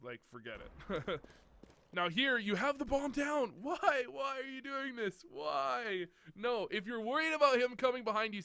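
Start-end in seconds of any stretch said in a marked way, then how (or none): 1.9-8.4 high-pitched, pleading exasperation; real coach's frustration, pitched up; exasperated disbelief. Real, not acted. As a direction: wail in dismay at a baffling mistake. Coach pleading over a baffling mistake.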